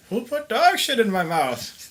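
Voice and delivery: put-on high, shouting old man, disgusted; outraged old geezer yelling. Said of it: "I better have an old guy voice".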